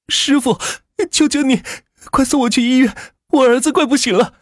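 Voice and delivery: very high, sobbing-voiced plea; tearful cry. A narrator's reading. Mother begging a driver to rush her child to hospital, tearful. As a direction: beg desperately with tears in the voice. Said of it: "嘴里带着哭腔喊道".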